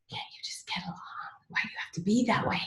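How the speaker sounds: hushed, breathy mock reproach; whispered reproach. Someone hushing her to just get along, whispered. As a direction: whisper the guilt trip. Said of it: "well, like, God".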